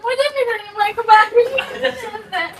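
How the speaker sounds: high tearful refusal, half laughing; sobbing and laughing together; crying. Real, not acted. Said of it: "real में रोने लगी भाई". (रोते-हँसते हुए)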